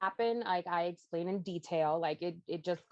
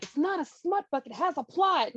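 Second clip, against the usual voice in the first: much higher, louder defensive retort; indignant protest; 10 semitones higher, 6 dB louder than usual. Retold or imitated. Author protesting to her husband about her book, much higher and louder.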